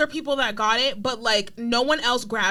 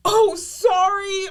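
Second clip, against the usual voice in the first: drawn-out, higher-pitched retold "oh, sorry"; mock apology; 6 semitones higher, much slower than usual. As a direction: stretch out an imitated apology, pitched up. Retelling the cookie eater's apology.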